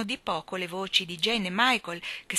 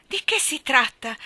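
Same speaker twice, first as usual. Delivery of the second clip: louder, higher, lively question; brisk questioning; 7 semitones higher, 7 dB louder than usual. A narrator's reading. Mother questioning the fallen maid, briskly. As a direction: ask it sharply, with raised voice. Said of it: "chiese missis Banks vivamente".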